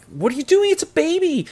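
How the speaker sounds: high-pitched mock panic; freaking out. The nun protesting over the baby, freaking out. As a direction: squeal it in imitated alarm. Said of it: "the nun is freaking out".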